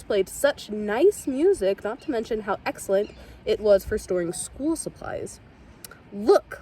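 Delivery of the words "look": plain reading voice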